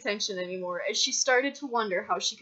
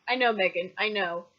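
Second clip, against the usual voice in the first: plain reading voice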